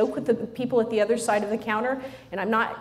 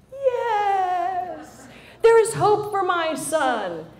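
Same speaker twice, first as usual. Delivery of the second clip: mock tearful joy; 10 semitones higher, 5 dB louder, much slower than usual. Mother's mock relief.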